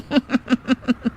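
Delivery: a short, sly chuckle; sly laugh. Acted. Jaggu sweet-talks Lata mausi, sly little chuckle. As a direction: chuckle as if the flattery is a clever trick. Goon flattering the mother.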